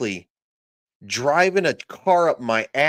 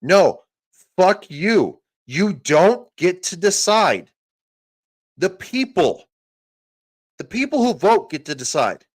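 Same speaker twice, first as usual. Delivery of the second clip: furious shout; 6 semitones higher, 5 dB louder than usual. Real, not acted. Cutting off Zuckerberg with a loud angry shout. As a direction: bellow it at him.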